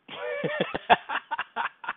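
high, drawn-out giggling laugh; amused laugh. Real, not acted. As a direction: laugh at your own wish. Laughs after Australian accent wish.

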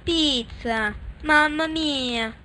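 hammed-up Italian accent, slow; jolly italian pizza chef. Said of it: "I'm Italian".

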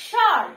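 স্বর hit loud on a strong opening sh; forceful pronunciation. Shown as a demonstration. Teacher reads the word out for pupils, forceful pronunciation. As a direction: punch স্বর as shor, no b sound. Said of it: "জোরে উচ্চারণ করতে হবে".

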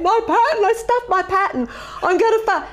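high, wailing mock blubber; imitating crying. Student crying to her instructor on the phone, high blubbering wail. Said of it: "I was crying and through my blubbering".

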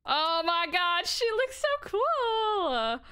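high, slow gushing admiration; gushing awe. Real, not acted. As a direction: swoon over it. Admiring new skin.

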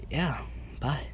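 whispering. Real, not acted. Signing off.

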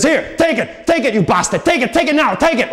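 high, frantic repeated demand; mock angry. Victim slapping coins into the mugger's hand, high mock-angry outburst. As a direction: act out angrily forcing it on someone. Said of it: "looking really angry".

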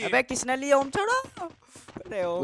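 high, loud protest; indignant demand. Real, not acted. Player demanding teammate drop the gun, indignant. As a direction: demand it back, pitch climbing sharply.

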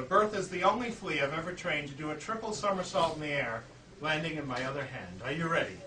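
ordinary talk, nothing marked

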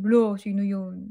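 a light put-on jealous tease; teasing. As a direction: needle him playfully about the song. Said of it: "asarin ko siya".

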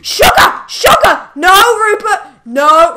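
very high, loud yelp of alarm; panicked shriek. Real, not acted. Player shrieking as the creeper hits the dog, panicked. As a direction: cry out as it blows up.

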